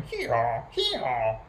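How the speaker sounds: two-note hee-haw bray; donkey braying. A narrator's reading. Storyteller voicing the donkey next morning, braying. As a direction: imitate a donkey, twice over. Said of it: "and the donkey going".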